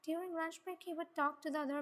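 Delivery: ordinary delivery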